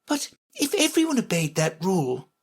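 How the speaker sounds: put-on high-pitched objecting girl; argumentative Alice. A narrator's reading. Alice to the Red Queen, high-pitched objection. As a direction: argue in a lifted voice. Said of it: "always ready for a little argument".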